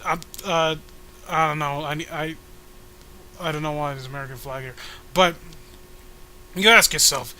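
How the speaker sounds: usual speaking voice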